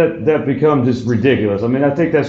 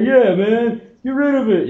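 much higher, mocking a clueless follower; dumb agreement; 9 semitones higher, slower than usual. Brainwashed idiots agree with the protest, mock dopey. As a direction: cheer along like a brainwashed idiot. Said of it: "brainwashed idiots will be like".

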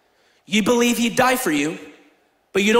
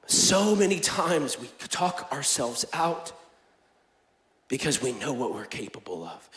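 much softer, slower and lower; quiet preaching; 6 semitones lower, 8 dB quieter than usual. Acted. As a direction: pull them in close, hushed. Sermon drops to intimate.

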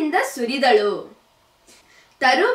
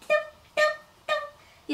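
much higher and softer tiny steps; tiny cub steps; 6 dB quieter than usual. A narrator's reading. Baby bear climbs the stairs, tiny soft patter.

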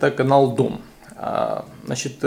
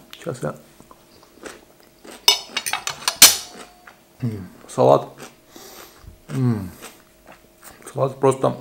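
talking while chewing food; muffled mouth-closed savoring hum; chewing with mouth full; 3 dB quieter than usual. Real, not acted. Seen 1: salad forked in at -4; closed lips, puffed cheeks chewing throughout.

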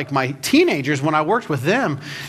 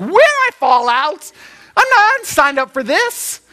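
mock alarmed echo; 9 semitones higher, 4 dB louder than usual. Novice rafter answers the guide, hammed-up alarm on "When". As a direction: throw "When" back in comic disbelief, then protest.

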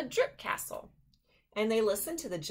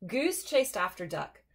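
plain reading voice

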